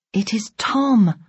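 ordinary delivery

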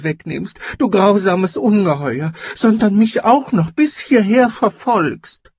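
high, thin, frightened cry of accusation; trembling thin voice. A narrator's reading. Frightened giant accusing his pursuer, trembling thin voice. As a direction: plead loudly in a high, trembling voice. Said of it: "rief mit zitternder, dünner Stimme".

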